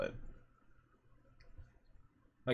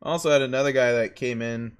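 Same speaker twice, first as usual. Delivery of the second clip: ordinary talk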